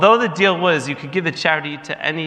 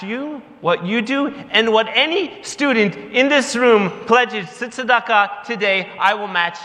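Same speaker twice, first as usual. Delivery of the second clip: higher, slower grand announcement; bold pledge; 8 semitones higher, slower than usual. Retold or imitated. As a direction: declare the match proudly. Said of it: "Rosie turns to him and says".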